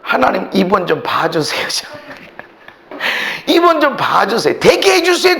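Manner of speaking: ordinary delivery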